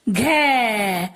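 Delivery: घै held long with falling pitch; drawn-out syllable. Shown as a demonstration. Teacher showing the child the matra sound, drawn-out घै. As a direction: stretch the vowel of घै. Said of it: "इसे बोलेंगे".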